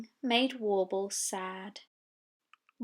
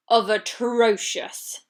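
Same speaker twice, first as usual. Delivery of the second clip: slow, louder atrocious; drawn-out atrocious; 5 dB louder, slower than usual. A narrator's reading. Narrator stressing how atrocious the choir sounds. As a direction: lean on atrocious.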